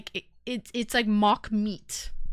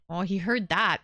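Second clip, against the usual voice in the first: usual speaking voice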